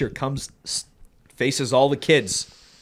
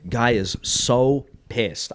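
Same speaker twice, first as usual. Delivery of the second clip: usual speaking voice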